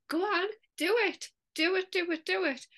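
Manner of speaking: high, insistent egging-on; convincing urging. Retold or imitated. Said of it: "it's very convincing".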